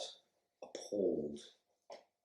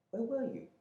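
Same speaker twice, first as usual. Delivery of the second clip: high, pleading voice of a shocked wife; frightened wife; 3 dB quieter than usual. Acted. Wife confronting her missing husband, high and pleading. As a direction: stammer out her hurt to the husband back from the dead.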